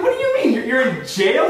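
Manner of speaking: high-pitched stunned disbelief; incredulous. Retold or imitated. Caller hearing his friend is locked up, high and incredulous. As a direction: squeak it out in astonishment. Shocked reply to jail news.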